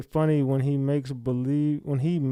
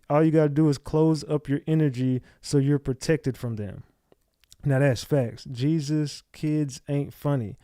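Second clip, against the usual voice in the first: ordinary delivery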